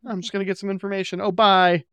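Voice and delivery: high, fast, breezy brush-off; mock hurried dodge. Mimicking Marcus slipping away from Delenn's question, high and fast. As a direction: rattle it off and flee.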